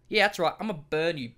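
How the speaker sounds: ordinary talk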